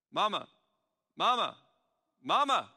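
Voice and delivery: put-on loud, high child calling for mom; insistent child's call; child calling mama. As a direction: call out like a child tugging for attention.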